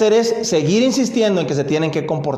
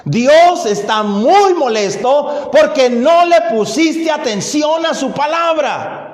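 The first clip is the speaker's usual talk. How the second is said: Samuel's rebuke; 6 semitones higher, 3 dB louder, slower than usual. Retold or imitated. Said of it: "le dice Samuel".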